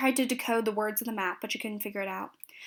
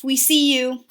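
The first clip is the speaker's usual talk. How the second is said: louder narrated call-out; booming shout; 3 semitones higher, 7 dB louder, slower than usual. A narrator's reading. Half-orc cleric calls out the spying elf, booming shout. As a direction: raise the voice for a storyteller's shout. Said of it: "made her voice boom again, shouting at the elf".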